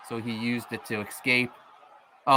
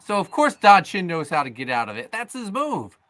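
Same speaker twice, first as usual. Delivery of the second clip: wrestling commentator; 8 semitones higher, 3 dB louder than usual. Acted. Live wrestling play-by-play.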